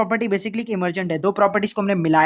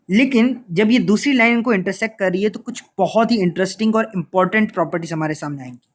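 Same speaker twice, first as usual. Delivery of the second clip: ordinary talk, nothing marked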